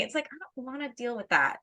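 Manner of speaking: ordinary delivery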